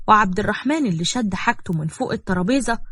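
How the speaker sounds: usual speaking voice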